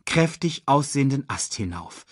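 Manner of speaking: ordinary delivery, nothing marked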